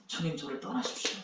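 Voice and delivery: whisper-soft humble confession; sinner's plea. Acted. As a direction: Peter at Jesus' knees, quietly pleading. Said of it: "죄인이 이렇게 말하거든요".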